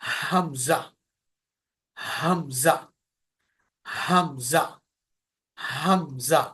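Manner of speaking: leading students to repeat हमजा with him; throaty hamza. Shown as a demonstration. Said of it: "मेरे साथ बोलिए".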